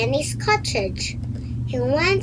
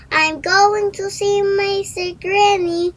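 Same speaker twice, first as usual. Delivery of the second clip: put-on bright, higher little girl voice; a child telling a stranger where she's going; 6 semitones higher than usual. A narrator's reading. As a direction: read it as an eager little girl. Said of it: "she said".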